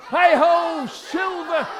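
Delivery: high, ringing heroic shout; big rallying cry; mighty cry. Acted. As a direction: call it out loud with a drawn-out last word. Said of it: "With a mighty cry of".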